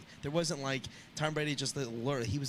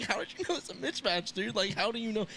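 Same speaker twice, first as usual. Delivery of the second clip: higher-pitched, incredulous retold question; mock amazement; 8 semitones higher than usual. Fan retelling his reaction to Tony Romo's predictions, amazed. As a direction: replay your disbelief at the call, pitched up.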